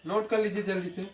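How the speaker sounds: usual speaking voice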